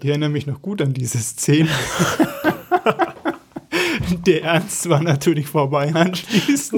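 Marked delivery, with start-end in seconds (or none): 1.7-4.2 high-pitched burst of laughter; real laughter. Real, not acted. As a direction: laugh long and high at the memory. Laughing at the stage fall memory.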